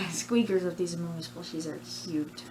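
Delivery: ordinary talk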